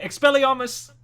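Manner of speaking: loud, high British spell cry; Amicus, British accent. Acted. British Amicus casting a spell, high loud British cry. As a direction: shout the spell in mock British. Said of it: "What if Amicus suddenly turned British?".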